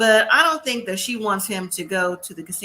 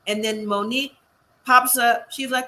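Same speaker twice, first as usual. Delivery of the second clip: ordinary talk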